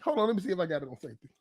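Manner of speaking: ordinary talk, nothing marked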